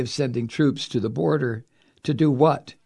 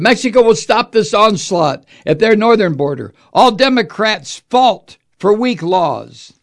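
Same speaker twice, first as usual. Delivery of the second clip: louder, higher sneering read; mock Trump quote; 6 semitones higher, 7 dB louder than usual. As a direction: jeer it as Trump blaming Democrats. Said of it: "he added".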